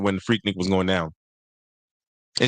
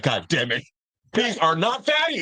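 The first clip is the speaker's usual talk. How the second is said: higher, drawn-out complaint; mock outrage; 6 semitones higher than usual. Man retelling his disappointment, mock outrage. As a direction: act out exasperated disbelief. Retold outburst of frustration.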